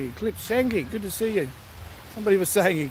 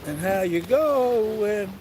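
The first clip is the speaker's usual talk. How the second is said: drawn-out Australian "going", very slow; slow Australian greeting; 3 semitones higher, much slower than usual. Shown as a demonstration. Host showing Aussie mates' greeting, very slow Australian drawl. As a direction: stretch out "And how you going?" the Aussie way, slowly. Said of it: "So all your Aussie mates could say".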